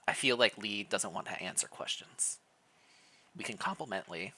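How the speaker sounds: hushed aside, barely voiced; a breathy confiding whisper. Real, not acted. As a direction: whisper it like a secret to the audience. Aside about Lee's mood.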